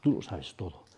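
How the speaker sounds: quietly, from the heart; a soft confession meant sincerely; soft, heartfelt. Acted. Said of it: "en un susurro".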